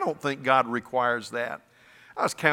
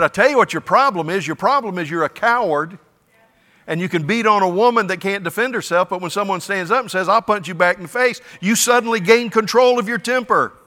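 louder, fast confrontation; retold stern rebuke; 6 semitones higher, 6 dB louder, faster than usual. Pastor retells confronting the husband, louder and fast. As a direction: call him out to his face.

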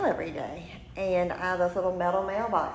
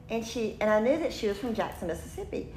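normal talking voice

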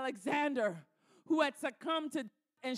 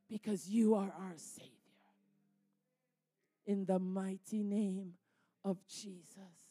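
closing prayer; 4 dB louder than usual. Acted. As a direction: pray it over them, ending hushed. Closing prayer over Isaiah 43.

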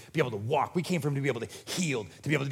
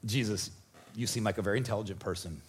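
mock dry reply; 8 semitones lower, 5 dB quieter than usual. Friends dryly answering Jesus.

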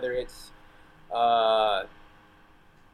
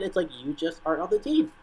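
ordinary talk, nothing marked

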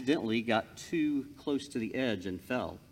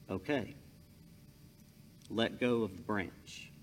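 calm God voice; 5 semitones lower, 9 dB quieter than usual. Acted. God gives the command.

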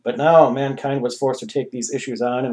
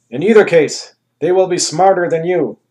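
grand ruler; 5 semitones higher, 7 dB louder than usual. Acted. Anus voice.

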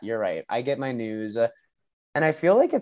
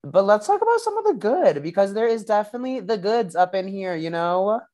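high playful voice; 7 semitones higher than usual. Acted. Playful sing-song switch to good side.

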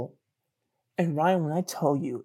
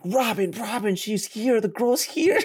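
high urgent alert, climbing as it goes; panicked excitement; 7 semitones higher than usual. Retold or imitated. Guy running to warn his friend, panicked excitement. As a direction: break the news in a mock frenzy.

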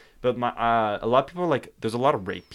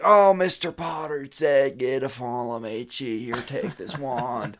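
put-on stoned old wand seller, voice starting high and sinking; drawn-out smoker's greeting, sliding down; bong-smoking Ollivander; 3 semitones higher, 3 dB louder, slower than usual. Shown as a demonstration. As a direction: croak it out like you just hit a bong. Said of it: "he's a smoker in this one".

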